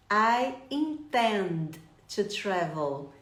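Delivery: I intend to travel, very slow; slow model sentence. Shown as a demonstration. Teacher giving the answer for viewers slowly. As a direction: spell it out slowly. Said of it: "Me ajudem?".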